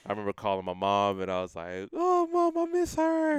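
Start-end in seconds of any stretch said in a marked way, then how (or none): 1.9-3.4 much higher, louder, whiny pleading to mom; hammed-up heartbroken whine, high and loud; mock whiny plea. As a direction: whimper it out like a lovesick kid, pitched way up and loud. Calling mom, missing girlfriend.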